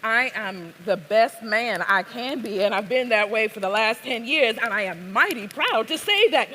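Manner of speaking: put-on emphatic Southern accent; firm, self-defending Southern delivery; Azel. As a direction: declare it proudly as a Southern man. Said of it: "I'm gonna attempt what is a Southern accent".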